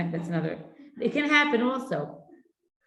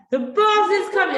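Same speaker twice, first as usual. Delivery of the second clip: much higher, louder urgent shout; urgent call; 12 semitones higher, 9 dB louder than usual. Retold or imitated. Mother rushing sleepy son, high urgent call. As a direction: call up the stairs in a hurry.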